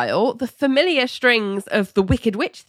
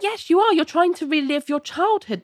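high-pitched, insistent comeback; retold squabble, pitched right up; mock argumentative retort; 10 semitones higher than usual. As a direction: contradict him in a high acted voice. Acted quarrel, contradicting John.

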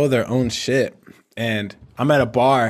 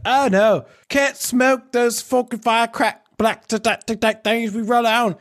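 higher, slow mock Aussie stammer; Australian accent; 10 semitones higher, slower than usual. Aussie explaining rolling papers, mock Australian accent. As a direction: stumble through it as the Aussie. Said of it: "He's like".

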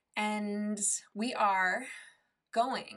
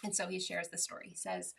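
ordinary talk

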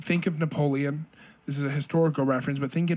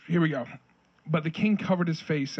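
ordinary talk

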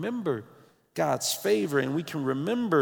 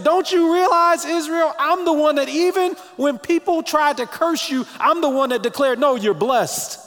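high, loud fervent proclamation; preaching at full force; 10 semitones higher, 7 dB louder than usual. Acted. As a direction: declare it with full conviction. Preaching God's voice.

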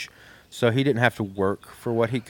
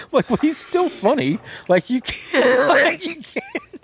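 high, breaking into laughter; laughing disbelief; 13 semitones higher than usual. Real, not acted. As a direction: squeak it out through laughter.

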